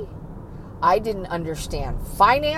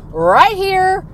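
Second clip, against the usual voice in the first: impatient demand; 7 semitones higher, 9 dB louder, much slower than usual. Retold or imitated. Acts impulsive past self.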